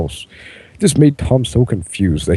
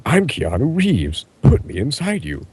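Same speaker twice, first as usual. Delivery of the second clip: mock scream; 6 semitones higher than usual. A narrator's reading. Pinkie Pie dances around declaring herself, high mock scream. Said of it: "dance around and scream".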